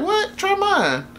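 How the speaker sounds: high, startled "What?"; surprised. Retold or imitated. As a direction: jumps up in surprise, offers his own. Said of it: "And he's like".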